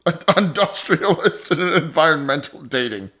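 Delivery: ordinary talk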